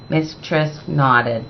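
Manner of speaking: plain reading voice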